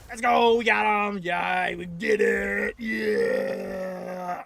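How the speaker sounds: loud triumphant shouting; victory cheer. Real, not acted. Gamer cheering after beating the boss, loud. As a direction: whoop at the win.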